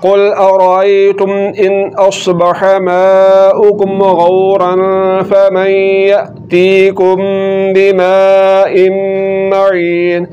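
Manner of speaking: slow, melodic tajweed recitation; tilawat-style chanting; Quran recitation. Acted. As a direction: recite the verse slowly and clearly. Recites the full verse.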